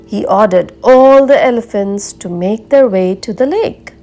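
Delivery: ordinary delivery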